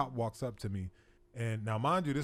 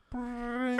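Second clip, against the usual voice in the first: high imitated ring with a laugh; imitated buzzer; 9 semitones higher than usual. Imitating the buzzer again, laughing. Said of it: "A few seconds later".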